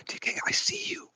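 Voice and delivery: a soft breathy whisper. Retold or imitated. Recalling a host's whispered shoutout to him. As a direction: lean in and whisper it. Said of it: "you were like".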